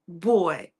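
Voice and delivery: ordinary talk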